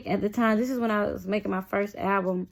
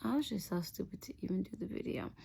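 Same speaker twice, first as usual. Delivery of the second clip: whisper; 13 dB quieter, faster than usual. Real, not acted. Confessing embarrassment.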